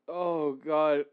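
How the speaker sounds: ordinary talk, nothing marked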